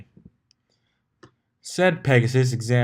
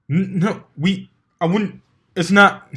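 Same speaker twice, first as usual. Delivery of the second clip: higher, loud, tripping over the words; flustered stammer; 10 semitones higher, 10 dB louder than usual. A narrator's reading. Pegasus asked if they've screwed yet, flustered. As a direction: blurt it out, caught completely off guard. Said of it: "The Pegasus appeared stunned".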